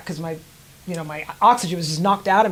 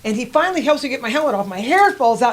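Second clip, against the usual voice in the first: normal talking voice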